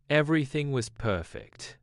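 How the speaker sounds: usual speaking voice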